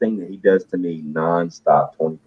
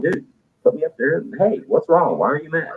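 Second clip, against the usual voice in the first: higher pleading ask; 8 semitones higher than usual. Retold or imitated. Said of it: "I'm like".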